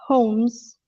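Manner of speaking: "Holmes" said like "homes", no L sound; silent L. Shown as a demonstration. Teacher explains the name's pronunciation, L silent. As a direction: drop the L in "Holmes". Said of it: "this is how people usually pronounce it".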